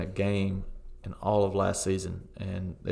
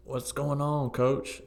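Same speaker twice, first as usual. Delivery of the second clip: higher-pitched mock call to the bench; mock yell; 6 semitones higher, slower than usual. Players complain to their coach from the pitch, mild mock yell. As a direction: imitate players calling out, without real volume. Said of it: "kinda yelling over to the bench".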